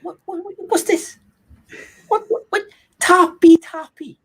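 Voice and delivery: hammed-up Russian accent, very high and drawn out. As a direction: squeal out the Russian accent in comic confusion. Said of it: "one of your wonderful accents".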